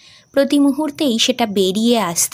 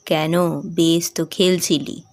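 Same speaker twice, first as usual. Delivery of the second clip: father's voice; 5 semitones lower, slower than usual. Acted. Father telling daughter the station name, lower. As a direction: answer simply like the father.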